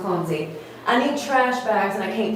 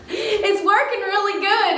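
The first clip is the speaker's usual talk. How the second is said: laughing; 10 semitones higher than usual. Real, not acted. Laughing at broken gadget.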